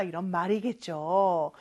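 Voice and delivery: normal talking voice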